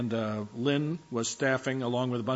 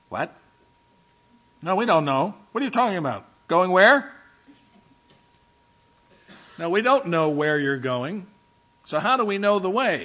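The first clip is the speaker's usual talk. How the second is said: confused Thomas; 5 semitones higher, 7 dB louder than usual. Retold or imitated. Thomas confused, questioning Jesus.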